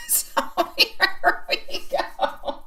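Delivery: giggling through the words; real laughter breaking in; laughing. Real, not acted. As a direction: laugh as you speak.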